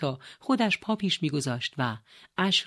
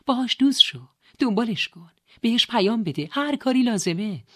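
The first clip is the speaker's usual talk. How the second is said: high, loud string of commands; emphatic, excited encouragement; eager urging; 6 semitones higher, 5 dB louder than usual. Acted. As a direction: urge him on insistently. Sister urging brother to pursue doctor.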